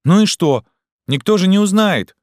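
put-on high loud boyish protest; offended child. A narrator's reading. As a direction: plead like an offended boy. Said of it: "обиженно доказывает он".

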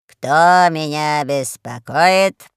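slow, heavy drawl; Панченго Мулити. A narrator's reading. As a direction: demand it lazily, drawing out each word. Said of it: "лениво шевеля толстыми".

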